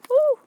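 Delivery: sudden high, loud "woo"; startled whoop. Real, not acted. Crafter almost drops some stuff, startled high whoop. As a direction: yelp as if nearly dropping something.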